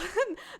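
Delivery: loud high laugh out of nowhere; bursting laugh. Real, not acted. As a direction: laugh hard at your own joke. Laughs at butt dial joke.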